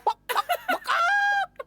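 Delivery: very high chicken clucking; chicken taunt. Retold or imitated. Drummer taunting through the cracked door, high mock clucking. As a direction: cluck it like a squawking hen.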